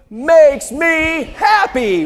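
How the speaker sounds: loud, drawn-out group chant; shouting. Real, not acted. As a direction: shout it out together, slowly. Audience chant after one two three.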